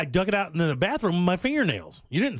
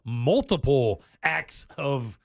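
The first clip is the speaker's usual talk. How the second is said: slow emphasis; 2 semitones lower, much slower than usual. Acted.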